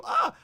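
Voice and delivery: very high, loud yelp; piercingly high retold "ah"; mock scream. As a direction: act out a sudden startled shriek. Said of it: "And I go".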